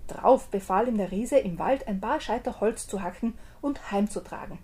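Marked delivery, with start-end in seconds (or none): none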